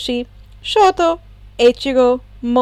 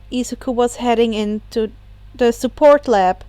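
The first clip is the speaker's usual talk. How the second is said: usual speaking voice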